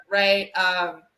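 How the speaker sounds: normal talking voice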